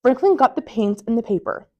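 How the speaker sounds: ordinary delivery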